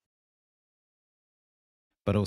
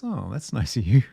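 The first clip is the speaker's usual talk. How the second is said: laughing; 4 semitones higher, 8 dB louder than usual. Real, not acted. Flattered by chat compliment.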